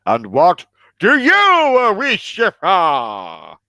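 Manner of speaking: slow, booming genie. Acted. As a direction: loom over them like a genie. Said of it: "That's my genie voice".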